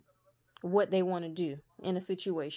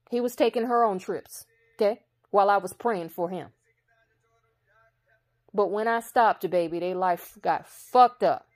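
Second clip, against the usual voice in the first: louder, heated testimony; preaching the grievance louder and louder; building rant; 6 dB louder than usual. Real, not acted. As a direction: let the anger swell louder. Rising rant about ex.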